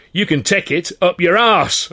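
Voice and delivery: loud, high and slow; roar. Acted. Actor roaring at his boss, defiant. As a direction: bellow it back. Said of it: "I roared".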